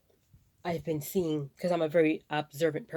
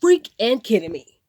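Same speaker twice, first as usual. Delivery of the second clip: outraged disbelief; 4 semitones higher, 10 dB louder than usual. Retold or imitated. Telling viewers how she reacted to hungry kids, loud outrage. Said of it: "And I'm like".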